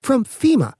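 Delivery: fired back fast and high; quick, high retort; quick answer. Acted. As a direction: blurt it out at once. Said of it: "Petra said quickly".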